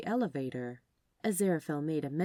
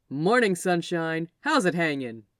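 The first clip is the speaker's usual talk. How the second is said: louder, brash, over-cheery salesman greeting; exuberant Gabriel; 7 dB louder than usual. Acted. Gabriel greeting Aziraphale in the lobby, loud over-cheery salesman. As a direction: boom it out like a too-bright morning person.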